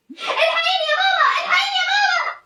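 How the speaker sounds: high, panicked call to mother; frightened cry for help. Acted. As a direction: scream for mom as you slip. Child slipping from tree, calling mother.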